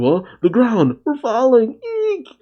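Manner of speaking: high-pitched panicked students; hammed-up panic as the ground gives way; mock freak-out. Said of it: "The students freak out saying".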